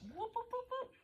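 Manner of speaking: quick high rising "whoop" sounds; playful whoops. Shown as a demonstration. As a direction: make high sound effects for the move. Voicing the spine zipping up.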